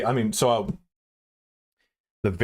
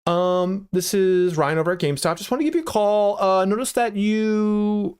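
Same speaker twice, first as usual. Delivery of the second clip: very high, bright-pitched salesman on a call; high sing-out cold-call voice; phone sales voice; 13 semitones higher, 3 dB louder than usual. Shown as a demonstration. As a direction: demonstrate an over-high telephone sales pitch. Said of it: "My phone sales voice used to be like".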